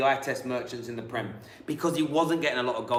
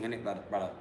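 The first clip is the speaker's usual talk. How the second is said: lightly put-on London street slang voice, softer; mock street London; 6 semitones lower, 9 dB quieter, much faster than usual. Host teasing co-host's put-on London street accent. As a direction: tease him with a put-on street voice. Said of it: "'Cause you put on the street thing".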